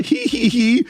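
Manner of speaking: high, gleeful mock cackle; a teasing villain's giggle; sorceress cackle. Sorceress giggling at the heroes' request, playful cackle.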